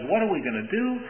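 a servant crying out in fear at the hit squad; mock terrified cry. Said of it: "he cries out and he says".

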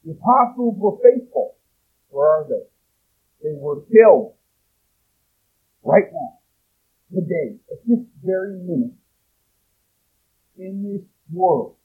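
loud, high, impassioned sermon; full-force preaching. Real, not acted. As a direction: thunder it at the congregation.

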